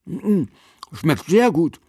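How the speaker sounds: high, muffled talk through food; boy talking while chewing; mouth full. A narrator's reading. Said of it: "verkündete er mit vollem Mund".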